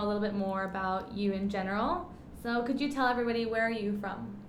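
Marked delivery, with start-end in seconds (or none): none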